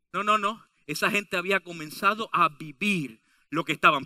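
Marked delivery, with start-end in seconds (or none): none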